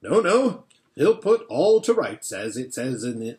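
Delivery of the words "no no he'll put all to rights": higher and louder, hearty assurance; a hearty beaver promising help; confident Beaver. Acted. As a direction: cheer them up boldly. Beaver reassuring the children.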